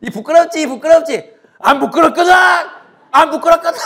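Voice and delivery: high, loud teasing and shouted denial; bickering brothers. Acted. Two boys fighting over being embarrassed, mock shouting match. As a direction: mimic two kids in a heated spat. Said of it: "이러다가 둘이 막 싸웁니다".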